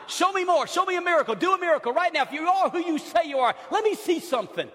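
high, loud demanding voice; demanding Herod. Acted. Herod demands a miracle from Jesus, high and loud.

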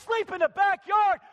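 loud, high shout on backyard; father shouting the son's words back at him; incredulous shout. Acted. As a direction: bellow it back in disbelief. Father repeats son's plea, shouting.

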